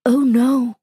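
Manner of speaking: usual speaking voice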